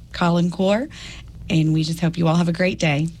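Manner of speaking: ordinary talk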